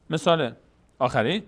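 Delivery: usual speaking voice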